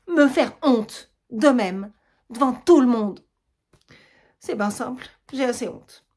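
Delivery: très lent et fort, chaque mot martelé; colère humiliée. Acted. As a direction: crache ta honte devant tous. Germaine humiliée et furieuse. (Germaine humiliée devant tout le monde, lente et forte)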